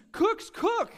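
very high, loud exclamation; ecstatic proclamation. Retold or imitated. Preacher at the peak of his point, very high and loud. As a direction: burst it out as the punchline. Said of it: "And he says".

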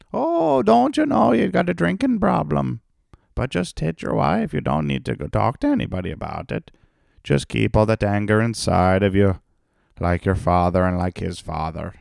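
high, loud, sing-song mocking voice; high, loud mock lecture that fades down; mock Irish parent. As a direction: sneer it as a preachy old-timer.

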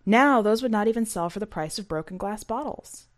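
plain reading voice

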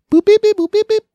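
high, loud spoken dial tones; imitated phone beeps. Making a phone call before the pickup, high mimicked keypad beeps. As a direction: beep out a number being dialed.

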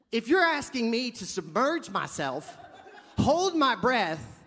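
high, loud, incredulous rant; escalating freak-out at the guide; hammed-up panic, rising to a near shriek; panicked outburst.

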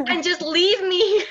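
high, loud, drawn-out and laughing; mock dismissal. Wife shooing husband away in retelling, mock exasperated. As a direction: send them away with playful exasperation.

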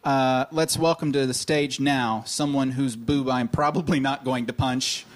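usual speaking voice

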